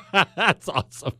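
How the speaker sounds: chuckling through the words; laughing. Real, not acted. Host laughing at Caesar's bridge stunt, amused. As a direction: laugh it out, delighted.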